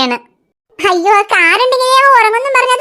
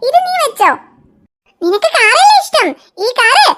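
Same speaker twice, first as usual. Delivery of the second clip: put-on cooing to a toddler; 3 semitones higher than usual. Aunt cooing to a child.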